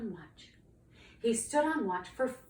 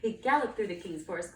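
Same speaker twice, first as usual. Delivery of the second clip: ordinary delivery, nothing marked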